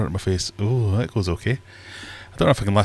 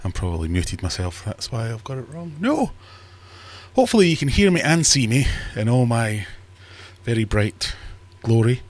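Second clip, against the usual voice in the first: ordinary talk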